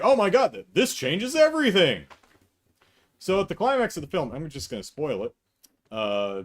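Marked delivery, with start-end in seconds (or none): 0.0-2.1 higher, louder hammed-up gasp of awe; mock shock. Mocking Mike's overreaction, hammed-up shock. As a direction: play fake astonishment. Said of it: "It'd be like".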